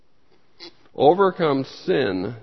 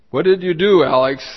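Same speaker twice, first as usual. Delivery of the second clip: retold scolding question; 3 semitones higher, 7 dB louder than usual. Retells adult confronting caught toddler.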